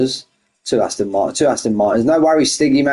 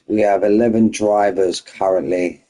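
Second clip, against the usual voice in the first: usual speaking voice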